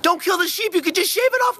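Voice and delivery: high, pleading little sheep voice; sheep's inner voice. Pretend. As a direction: beg like a sheep about to be killed. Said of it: "inner monologue with sheep".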